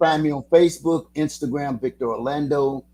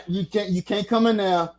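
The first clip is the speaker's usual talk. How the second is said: ordinary talk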